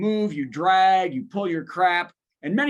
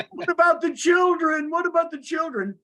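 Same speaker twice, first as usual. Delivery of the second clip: high-pitched mock outcry; mock alarm; 9 semitones higher, 3 dB louder than usual. Comedian mimics worry over kids at his show, mock alarm. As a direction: plead it high, in exaggerated concern.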